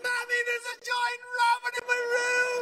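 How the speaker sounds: very high hammed-up child wail; panicked little boy. Son to mother about the rabbit, high panicked wail. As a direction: wail it up high like a scared kid.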